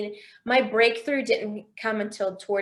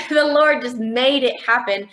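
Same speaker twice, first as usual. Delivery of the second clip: ordinary delivery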